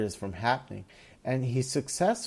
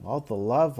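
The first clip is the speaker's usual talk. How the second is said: usual speaking voice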